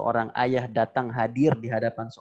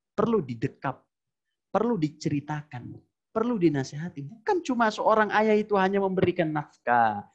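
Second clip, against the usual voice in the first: impassioned preaching; 7 semitones higher than usual. Acted. Preaching at full force.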